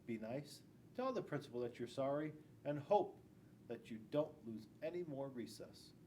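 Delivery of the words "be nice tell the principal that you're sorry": usual speaking voice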